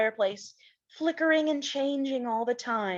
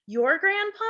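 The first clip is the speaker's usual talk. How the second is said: surprised Laura; 6 semitones higher than usual. Acted. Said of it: "Laura asked".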